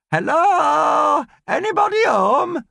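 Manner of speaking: high, cheerful call up the stairs; gaily shouting. Acted. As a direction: sing out a bright greeting from downstairs. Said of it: "Nan shouts gaily from downstairs".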